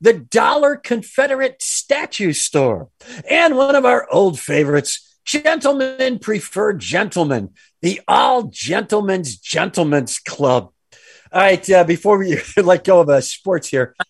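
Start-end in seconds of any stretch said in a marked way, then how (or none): 0.0-10.7 mock ad read.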